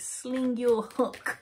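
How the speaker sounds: very slow, emphatic sling your hook; drawn-out hometown dismissal. Shown as a demonstration. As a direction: stretch every word of "Sling your hook". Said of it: "as we'd say it in my hometown".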